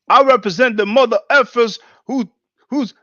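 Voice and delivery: high, loud mocking imitation; mimicking the debater. Commentator mimics the debater's boast.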